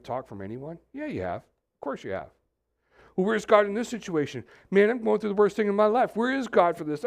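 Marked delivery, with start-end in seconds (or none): 3.2-7.0 higher, louder whiny lament; complaining believer. Retold or imitated. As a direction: whine about your worst day to God.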